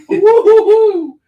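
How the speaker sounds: loud high woo-hoo whoop; high whooping laugh. Real, not acted. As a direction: whoop and cackle gleefully. Whoops after southern joke.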